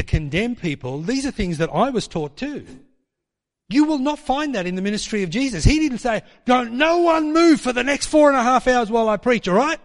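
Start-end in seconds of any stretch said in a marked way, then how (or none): 6.4-9.8 loud, high-pitched mock command; hammed-up bossy preacher, pushed loud and high; mock stern preacher. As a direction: order the crowd about at full voice. Mock preacher ordering the crowd.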